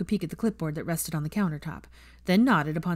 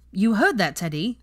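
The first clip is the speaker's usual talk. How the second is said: louder, sharp snapping scold; snapped; 3 semitones higher, 6 dB louder than usual. Acted. Grandmother snapping at Teddy, louder and sharp. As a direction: snap at the boy. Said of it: "Andromeda snapped".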